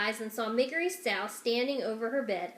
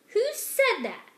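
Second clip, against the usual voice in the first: startled; 12 semitones higher, 6 dB louder, slower than usual. Acted. Startled princess.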